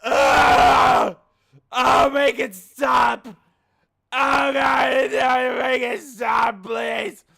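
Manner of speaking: shrill pleading screams, slow and drawn out; agonized screaming. Pretend. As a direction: beg for it to stop in agony. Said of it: "This is how it's gonna go".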